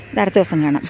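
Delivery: ordinary talk, nothing marked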